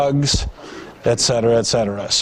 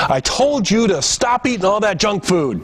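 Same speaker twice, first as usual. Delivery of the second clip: anger at victim; 7 semitones higher than usual. Retold or imitated. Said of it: "Anger at the victim".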